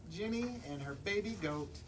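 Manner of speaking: plain reading voice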